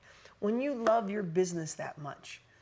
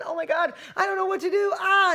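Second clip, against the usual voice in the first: frantic shout, much higher and louder; panicked outburst; 11 semitones higher, 6 dB louder than usual. Retold or imitated. Overwhelmed entrepreneur who can't choose a platform, panicked shout. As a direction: throw up your hands and yell in overwhelm. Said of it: "You start think-, thinking".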